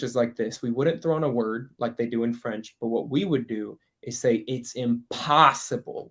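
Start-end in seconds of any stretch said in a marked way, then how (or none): 4.5-6.1 slow, heavy stress on the POS of "impossible"; stress on one syllable. Shown as a demonstration. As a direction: stretch and lean on the middle syllable of "impossible". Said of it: "We would put the emphasis on one".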